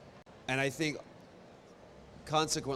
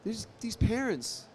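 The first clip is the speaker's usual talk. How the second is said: ordinary delivery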